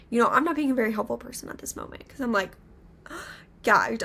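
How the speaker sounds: normal talking voice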